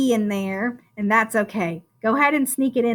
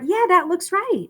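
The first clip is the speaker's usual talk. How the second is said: approving; 6 semitones higher than usual. Shown as a demonstration. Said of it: "look at words and say".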